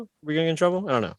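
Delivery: ordinary talk, nothing marked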